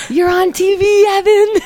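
high-pitched, loud singsong announcement; hammed-up stoned shout; stoned excitement. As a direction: call it out high and silly. Acting out stoned self calling Evan.

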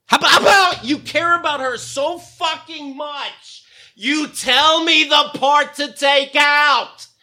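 furious at the friend, demanding an answer; real angry rant. Real, not acted. Ranting at absent friend.

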